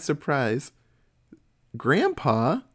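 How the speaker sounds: normal talking voice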